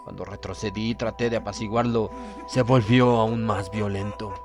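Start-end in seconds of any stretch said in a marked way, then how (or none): none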